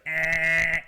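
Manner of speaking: loud, high blaring alarm noise; alarm clock imitation. Dreamer jolted awake by his alarm, loud mimicked buzz. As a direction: imitate a jarring alarm going off. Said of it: "Just like".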